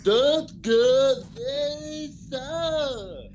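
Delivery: high, loud, very slow put-on voice; hammed-up slow high voice; mock cousin voice. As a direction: stretch every word like an odd funny voice. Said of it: "That's what my cousin sounds like".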